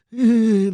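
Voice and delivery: a sharp burst of laughter; loud mocking laugh. Real, not acted. Mocking a rival on stream, bursting out laughing.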